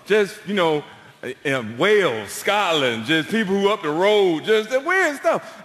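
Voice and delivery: usual speaking voice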